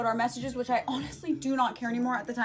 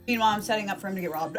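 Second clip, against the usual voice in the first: ordinary talk, nothing marked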